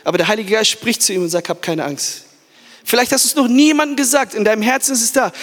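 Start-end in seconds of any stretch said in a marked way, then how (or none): 1.5-2.2 soft, hushed comfort; gently calm a frightened man; tender reassurance. Acted. The Holy Spirit soothes Paul, soft and tender.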